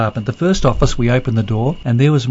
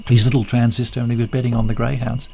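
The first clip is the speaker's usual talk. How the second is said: normal talking voice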